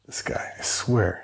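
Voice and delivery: slow exasperated whisper aside. Real, not acted. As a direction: whisper it under your breath. Aside about the cat.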